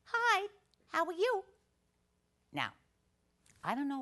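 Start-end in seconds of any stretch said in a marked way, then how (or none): 0.0-1.4 put-on high, small kid voice; little child's voice. Comic telling the audience about a stranger in the next stall, small high voice. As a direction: chirp it like a tiny child. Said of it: "a little voice from one of the other stalls says".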